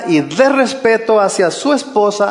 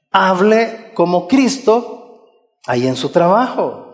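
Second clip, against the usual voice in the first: normal talking voice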